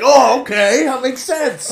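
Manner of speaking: high, loud mock understanding; imitating won-over customers. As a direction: play sudden, wholehearted agreement. Customers suddenly agree in retelling.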